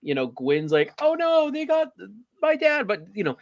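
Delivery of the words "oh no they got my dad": high-pitched mock distress; hammed-up worry in a high voice; mock panic. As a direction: imitate a panicked daughter, pitched way up. Mock quote of Gwen's panic.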